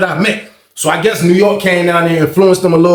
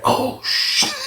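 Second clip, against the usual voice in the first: soft, unvoiced retold shock; hushed inner-voice amazement; stunned hush; 10 dB quieter than usual. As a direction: breathe it out as a silent thought.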